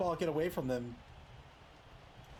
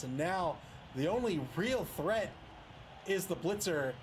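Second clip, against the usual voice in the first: ordinary talk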